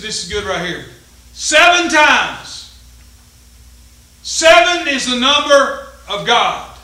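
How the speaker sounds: ordinary delivery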